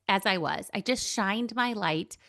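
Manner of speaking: ordinary talk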